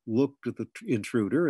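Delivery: plain reading voice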